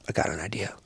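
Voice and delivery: low, very soft scheming voice; hushed retold plotting; conspiratorial. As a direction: share a secret plan. Buddy hatching a plan quietly.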